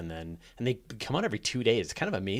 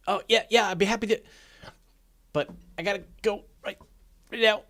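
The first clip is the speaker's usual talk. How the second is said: higher, quick mock-cheerful brush-off; fake-polite brush-off; 9 semitones higher, 3 dB louder, much faster than usual. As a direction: act overly eager, then rush to hang up. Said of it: "And I'm like".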